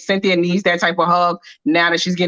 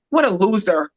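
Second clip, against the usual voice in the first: imitating Peter's scorn. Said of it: "the way he said it was kinda like".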